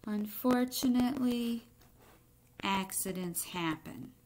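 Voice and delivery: put-on expressive read-aloud to children; story reading. A narrator's reading. Teacher reading a picture book to the class, expressive narration. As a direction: tell the egg's story to the class, lightly dramatic.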